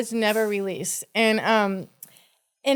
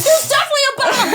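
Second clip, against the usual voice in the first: higher, fast, frantic bomb warning through laughter; panicked alarm; 5 dB louder, much faster than usual. Retold or imitated. Host warning everyone on the plane, in frantic higher alarm. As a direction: yell it like the plane is about to blow. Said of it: "I was like".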